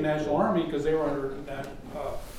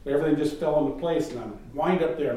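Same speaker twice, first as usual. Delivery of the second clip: ordinary talk, nothing marked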